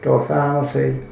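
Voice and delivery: plain reading voice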